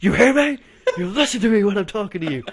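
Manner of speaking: loud mock yell at her; retold shout. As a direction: shout it like a scolding from the stage. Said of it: "Shout at her from the stage".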